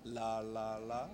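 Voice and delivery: "La, la, la" sung out with the tongue tip up; demo syllables. Shown as a demonstration. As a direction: model "La, la, la" slowly, tongue touching the top. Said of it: "Now try this for me".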